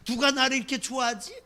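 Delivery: usual speaking voice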